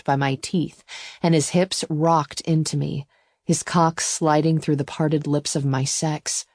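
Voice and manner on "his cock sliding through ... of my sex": ordinary delivery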